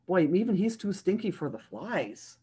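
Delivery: usual speaking voice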